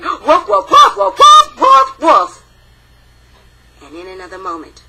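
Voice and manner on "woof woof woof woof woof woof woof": much higher and louder woofs, drawn out; big fierce barking close by. Acted. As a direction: bark as loud as you can. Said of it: "the sound came again".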